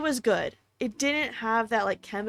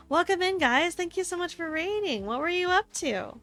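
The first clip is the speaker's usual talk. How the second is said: cute anime streamer; 6 semitones higher than usual. Real, not acted. Welcoming raiders.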